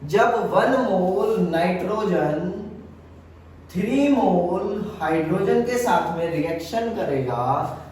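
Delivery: slow, each word spaced, वन and three stressed; slow emphatic teaching. Shown as a demonstration. Teacher slowly repeating the rule for students. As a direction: spell it out slowly for the class. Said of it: "समझना मैंने क्या चीज बोला".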